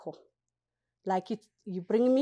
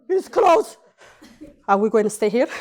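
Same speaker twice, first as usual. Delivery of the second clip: loud, high frightened gasp then cry; startled gasp and panic; 8 semitones higher, 9 dB louder, faster than usual. Retold or imitated. Newcomer frightened in her first elevator, panicking. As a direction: panic as the doors shut.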